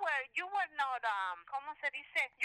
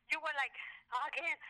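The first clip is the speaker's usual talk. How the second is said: ordinary delivery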